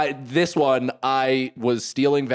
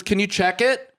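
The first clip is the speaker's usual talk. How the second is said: higher, tentative wife's voice; nervous wife; 8 semitones higher than usual. Retold or imitated. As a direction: ask him to check, uneasy and higher. Wife asks again.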